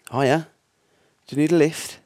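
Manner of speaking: nervous driver calling out a friendly offer; forced friendly greeting. Acted. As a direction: sound casual to a stranger you fear. Greeting a stranger on the road.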